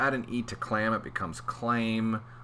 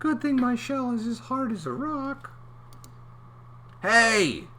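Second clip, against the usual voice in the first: very high little voice; comic high clam; 12 semitones higher than usual. Acted. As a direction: squeak it as the clam.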